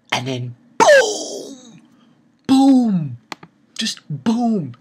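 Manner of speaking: high, loud drawn-out booms; excited boom. Real, not acted. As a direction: shout each boom with glee. Hyped retelling of the big hit.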